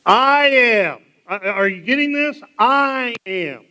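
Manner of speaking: loud and high, fed up after the tenth ask; annoyed emphatic shout; exasperated loud "I am". Shown as a demonstration. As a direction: shout it like you've been asked ten times. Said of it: "about the tenth time, what do you think I'm gonna say".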